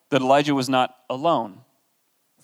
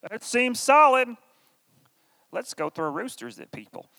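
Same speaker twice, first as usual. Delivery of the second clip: a silly cartoon vegetable cheerfully agreeing; VeggieTales vegetable voice; 8 semitones higher than usual. The gullible crowd backing Jezebel's crazy plan, in a high cartoon voice. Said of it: "the people's voices as ... characters from VeggieTales".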